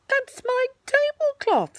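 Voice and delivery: high, slow indignant bear; slow, aggrieved little bear; Pooh voice. A narrator's reading. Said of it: "said Pooh".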